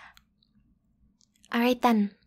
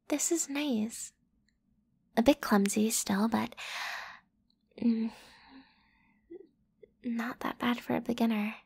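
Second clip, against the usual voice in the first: soft whisper; 7 dB quieter than usual. Acted. Soft praise after kiss.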